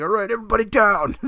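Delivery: high, fast, loud command; mock commanding order. Jumping on the bank counter, fast mock command. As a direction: bark it at the bank.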